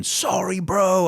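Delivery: retold shout. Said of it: "Tries to hit me while shouting".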